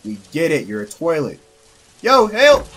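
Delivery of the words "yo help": loud scared cry for help; scared horror game streamer. Real, not acted. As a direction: yell for help, much louder.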